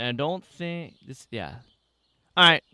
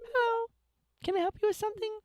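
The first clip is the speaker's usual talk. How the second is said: high character voice; 4 dB quieter, faster than usual. Pretend. Voices NPC dialogue box.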